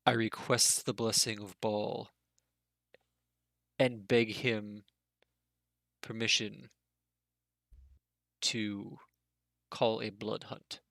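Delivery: lightly voiced solemn goblin warrior; kneeling supplicant. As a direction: beg permission with halting reverence. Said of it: "And he says".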